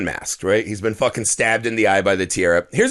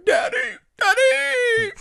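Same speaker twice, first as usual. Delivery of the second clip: very high squealed wail; mock crying daughter; slower than usual. Daughter cries for her dad, high mock wail. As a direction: imitate a crying girl, far up in pitch. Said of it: "The daughter's fucking crying".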